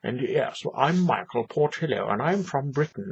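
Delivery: lightly voiced posh British accent, pleased with himself; a hint of plummy upper-class British. Portillo introducing himself to Americans in a mild posh British accent. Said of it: "with his posh little voice".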